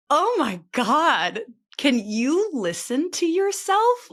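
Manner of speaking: high, slow, gushing quote; gushing friend. Retold or imitated. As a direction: stretch it out like an excited friend. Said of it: "but she's like".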